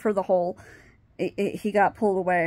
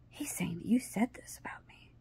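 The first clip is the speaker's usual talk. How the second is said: whispered accusation; 10 dB quieter than usual. Retold or imitated. Friend on the phone confronting her, whispered accusation.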